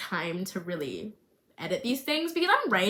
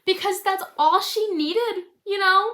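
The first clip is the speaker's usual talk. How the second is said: emotional; 10 semitones higher, 5 dB louder than usual. Real, not acted. Emotional about character's need.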